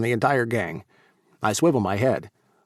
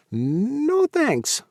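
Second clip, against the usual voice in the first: high squeaky refusal; comic recoil; 14 semitones higher than usual. A narrator's reading. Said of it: "He rears back comically".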